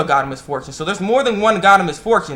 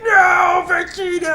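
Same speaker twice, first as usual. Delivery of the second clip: very high, strained yell; drawn-out comic shriek; Nappa's mock scream; much slower than usual. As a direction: cry out in doomed despair, hammed up. Nappa's cry imitated.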